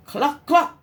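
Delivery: mock cluck. Chicken answers.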